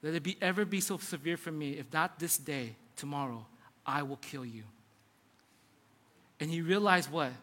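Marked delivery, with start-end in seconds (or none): 0.0-4.6 low, hushed death threat; quiet threat. Retold or imitated. Queen's letter to the prophet, quietly menacing. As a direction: read it softly and low, sinking further.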